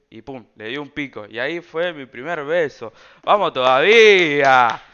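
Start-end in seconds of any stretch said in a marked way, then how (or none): 3.2-4.8 loud, slow victory shout; drawn-out shout of joy at his first kiss; triumphant cheer. Real, not acted. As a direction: cheer it like a goal.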